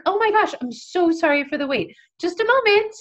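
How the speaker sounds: sugary hostess voice, smiling through stress; overly sweet apology. Retold or imitated. Apologizing to waiting guests.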